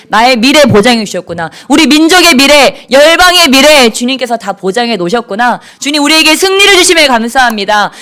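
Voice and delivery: prayer building to loud, high shouted thanks; acted jubilant prayer. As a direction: start in dawning realization, swell into full-force praise. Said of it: "기도하다 보니까 그게 깨달아지는 거예요".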